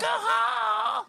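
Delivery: high rock star screech; [name] voice. Pretend. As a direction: scream it like a stadium rock singer. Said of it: "a moment of chaos as [name]".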